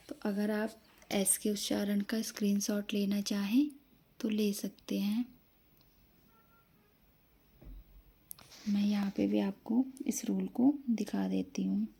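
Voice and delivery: soft whispered aside to the class; hushed teacher aside. Real, not acted. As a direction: drop to a whisper for the side note. Aside about taking a screenshot.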